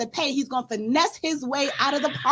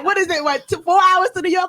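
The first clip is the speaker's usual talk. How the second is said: ordinary talk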